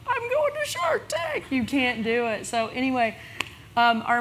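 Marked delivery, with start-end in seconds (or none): 0.1-1.5 much higher, slow giddy squeal; bursting excitement. Pretend. Secret she can't tell anyone, giddy high squeal. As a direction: gush it like a secret you can't keep.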